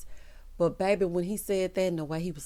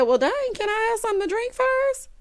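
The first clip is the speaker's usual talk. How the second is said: nervous Zach. Retold or imitated.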